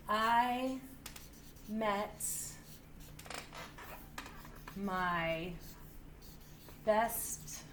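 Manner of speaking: slow, quiet, word by word as she writes; dictating while writing. Shown as a demonstration. Teacher dictates her journal sentence slowly while writing it. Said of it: "So I indented here".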